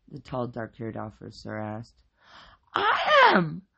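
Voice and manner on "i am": very high, loud shriek; practically shouting. A narrator's reading. Wife identifies herself to the officer, shouting. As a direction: shriek it out eagerly. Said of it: "Rita practically shouted".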